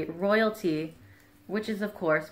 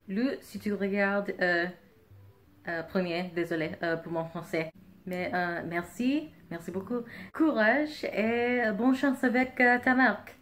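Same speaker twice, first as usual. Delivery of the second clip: English speaker's French, hesitant; careful learner's French with apology; foreign accent in french. Real, not acted.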